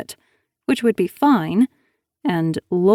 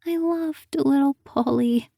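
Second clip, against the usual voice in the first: higher, small whimpering voice; 7 semitones higher than usual. A narrator's reading. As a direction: whimper it like a hurt child. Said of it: "she whimpered".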